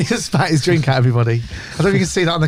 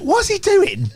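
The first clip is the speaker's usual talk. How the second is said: baffled defenders; slower than usual. Retold or imitated. Defenders baffled by Tony Adams.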